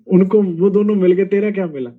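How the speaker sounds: ordinary delivery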